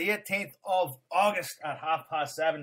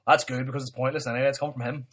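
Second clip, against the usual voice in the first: ordinary delivery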